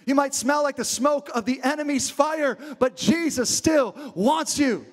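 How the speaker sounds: impassioned preaching with drawn-out key words. Real, not acted. Preacher promising the room Jesus still wants them, impassioned. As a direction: drive it home, stretching the big words.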